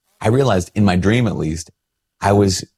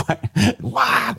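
high, incredulous mock why; exasperated why. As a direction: throw up your hands, pitched way up. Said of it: "I'm like".

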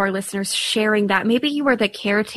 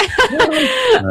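embarrassed high laugh; 13 semitones higher, 4 dB louder than usual. Real, not acted. Laughs at her own slip.

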